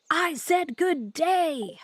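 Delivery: loud, slow, each word hammered; furious repeat. Acted. Streamer quoting Wonka's famous refusal, slow furious shout. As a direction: bellow the dismissal like an outraged Wonka.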